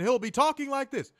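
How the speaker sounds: high, excited announcer impression; excited Joe Tessitore. Podcaster mocking the announcer's delivery, high and excited. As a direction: push the voice up, mocking an excited commentator. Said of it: "that little cubicle voice that Joe Tessitore does".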